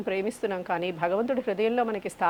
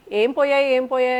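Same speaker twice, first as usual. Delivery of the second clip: a loud, high cry of what's gone; mock shout; 4 semitones higher, 7 dB louder than usual. [name] mimics the neighbour rushing out, loud mock call. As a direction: call out in alarm, half-acted. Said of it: "నేను గట్టిగా అరవగానే".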